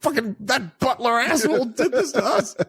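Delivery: high, laughing mock rage; hammed-up angry Bruce, laughing through it; mock furious Bruce. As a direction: rant at the butler, half laughing. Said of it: "He's like".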